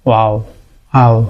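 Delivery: ওয়াও with lips rounded like a petal; rounded lips demo. Shown as a demonstration. Teacher showing the waw sound, lips rounded like a petal. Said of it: "ফুলের পাপড়ির মতো করে".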